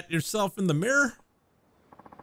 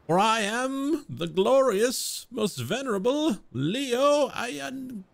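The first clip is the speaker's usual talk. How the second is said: higher, slow and pompous; grand self-proclamation; 8 semitones higher, slower than usual. Acted. Self-styled noble proclaims his full name grandly to the unimpressed commoner. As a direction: announce your own glory like a herald.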